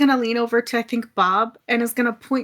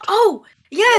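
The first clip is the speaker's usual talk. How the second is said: eager exclaim; 8 semitones higher, 3 dB louder than usual. Acted. Tremaine eager to board.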